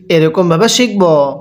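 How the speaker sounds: normal talking voice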